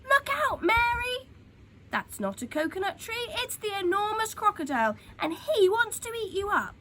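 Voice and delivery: high, loud alarmed warning; urgent warning shout. A narrator's reading. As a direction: yell it so the children run. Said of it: "shouted Humpy Rumpy".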